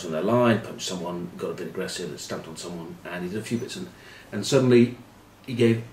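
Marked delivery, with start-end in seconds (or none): none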